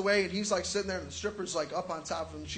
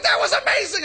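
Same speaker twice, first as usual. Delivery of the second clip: high, loud, laughing rave; excited friend; 12 semitones higher, 9 dB louder than usual. Retold or imitated. The friend raving about the stripper, high and loud. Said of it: "He's like".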